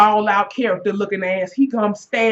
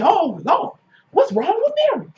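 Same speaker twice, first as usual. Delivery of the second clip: high, alarmed cry; 10 semitones higher than usual. Retold or imitated. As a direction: shriek it out in alarm. Retelling her alarmed reaction.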